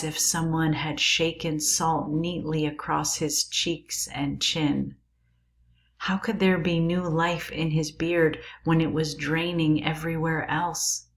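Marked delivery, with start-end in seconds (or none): none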